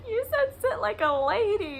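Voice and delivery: high, loud giggling mimic of the boy; boy cracking up at the phrase; laughing child. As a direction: cackle it out like a kid who can't stop. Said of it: "he was like".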